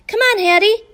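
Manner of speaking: high, loud eager call; excited bark. A narrator's reading. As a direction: call her into the game. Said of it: "I bark".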